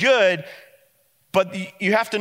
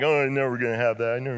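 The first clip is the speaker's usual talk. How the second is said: sulking whine; 4 semitones lower, 4 dB quieter, much faster than usual. Retold or imitated. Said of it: "probably still whining, like, sulking".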